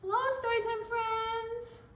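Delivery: high, squeaky puppet greeting; puppet elephant voice. Pretend. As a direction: give the toy elephant a chirpy little voice.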